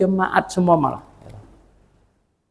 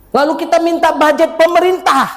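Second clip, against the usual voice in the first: emphatic; 11 semitones higher, 6 dB louder than usual. Acted.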